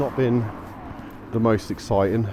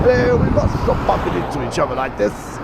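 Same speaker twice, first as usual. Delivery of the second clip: higher, hammed-up cheesy greeting; comedian's mock neighbour; 7 semitones higher than usual. Quoting the comedian's skit about meeting a neighbour, hammed up. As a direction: play the smug neighbour at his car. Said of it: "What a skit that is".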